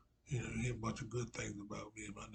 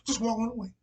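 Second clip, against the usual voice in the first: put-on the prophet quoted in a loud South Carolina accent; Southern Carolina accent; 9 semitones higher, 12 dB louder than usual. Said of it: "in that old South, Southern, uh, Carolina accent".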